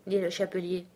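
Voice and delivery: ordinary delivery, nothing marked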